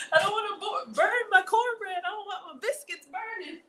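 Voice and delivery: high, laughing whine about the cornbread; squealing laugh. Real, not acted. Fretting over burning cornbread, high laughing whine. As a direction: squeal it half laughing, half crying.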